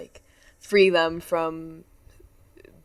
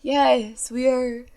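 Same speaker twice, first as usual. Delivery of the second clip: a hint of a polished announcer greeting; mock announcement voice; 5 semitones higher, 4 dB quieter, slower than usual. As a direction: tease mom by imitating her announcer voice. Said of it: "And then she's like".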